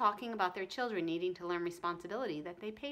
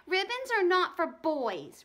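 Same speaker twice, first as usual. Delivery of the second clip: slow, louder, higher scolding; indignant girl; 8 semitones higher, 7 dB louder, slower than usual. A narrator's reading. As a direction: lecture him, outraged. Ramona scolds Howie.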